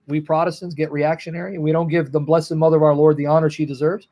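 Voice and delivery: ordinary delivery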